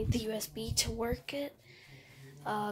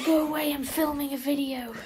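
annoyed shout; 4 semitones higher, 6 dB louder than usual. Real, not acted. Shooing someone away mid-filming.

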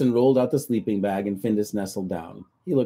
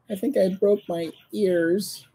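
high, slow, pitiful little whine; whimpering; 10 semitones higher, slower than usual. Acted. Cat complains to Petson about his ears, slow whimper. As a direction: sound sorry for yourself, slowly. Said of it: "the cat whimpered".

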